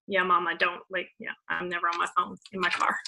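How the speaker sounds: mock aside to mom. Speaker jokingly answers her mom about phone use, soft quick aside. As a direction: dodge mom's scolding with a hushed quip.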